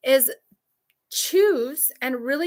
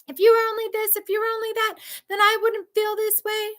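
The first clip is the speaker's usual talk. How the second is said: much higher, mock screaming abuser; imitated screaming narc; 11 semitones higher than usual. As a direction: shriek the insults as he did. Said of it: "My narc would scream at me".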